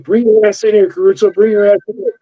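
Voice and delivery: high, yelled taunt through the cell door; screaming convict. Retold or imitated. Convict screaming at the officer through the door, high angry yell. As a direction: dare the guards to come in, shouting. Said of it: "He's like".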